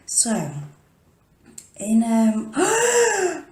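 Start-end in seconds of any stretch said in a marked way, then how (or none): 2.5-3.4 sharp high gasp of remembering; sudden gasp. Real, not acted. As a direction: gasp as it suddenly comes back.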